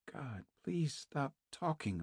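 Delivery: a silent scream kept inside; inner scream. A narrator's reading. Naomi pleads inwardly for Kate to stop, hushed tense plea. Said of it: "a voice inside Naomi was screaming".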